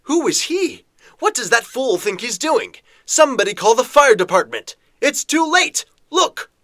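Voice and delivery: high, excited crowd voices; alarmed crowd. Acted. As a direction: shout in alarm from the street.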